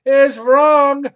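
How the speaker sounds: high, loud, slow what is wrong with you; teasing the cohost for her silly idea, loudly incredulous; mock outrage. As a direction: drag it out in exaggerated disbelief.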